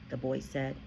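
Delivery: usual speaking voice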